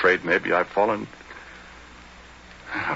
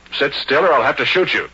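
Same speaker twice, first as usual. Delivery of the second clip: threat; 8 semitones higher, 7 dB louder than usual. Acted. Threatening a guest at gunpoint.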